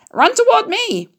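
very high honking yell; yelling gander. A narrator's reading. Gander calling Wilbur over, high honking yell. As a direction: honk it out like a gander. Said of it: "yelled the gander".

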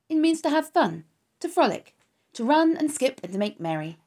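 bursting with joy over the idea of play; eager young pig. Acted. Wilbur explains play eagerly.

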